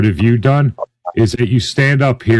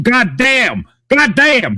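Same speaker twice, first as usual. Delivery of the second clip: rising higher, slow exasperated goddamn; building outrage, second one much higher; exasperated exclamation; 9 semitones higher, slower than usual. Real, not acted. Heated panel argument about racism.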